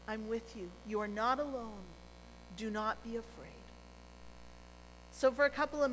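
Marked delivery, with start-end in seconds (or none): 0.1-3.5 reassuring. Acted. Jesus speaking in the storm.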